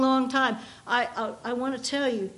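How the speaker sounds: ordinary talk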